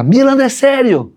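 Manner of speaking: high, slow, tearful disbelief; wife crying. Retold or imitated. Wife crying to husband at son's injury, high tearful. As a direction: cry out in shock like his wife. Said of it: "minha esposa começa a chorar".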